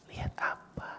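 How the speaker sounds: whispered, very soft question; low voice. Acted. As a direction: answer in a hushed whisper. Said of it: "maka kita pakai suara rendah".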